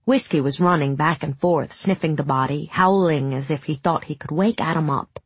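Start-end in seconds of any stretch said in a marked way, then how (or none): none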